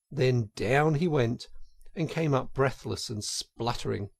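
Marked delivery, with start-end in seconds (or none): none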